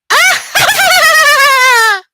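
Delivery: high shrieking witch cackle. Acted. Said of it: "I sound like the Witch of the West".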